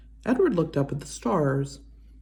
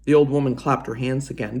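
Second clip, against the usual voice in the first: ordinary delivery, nothing marked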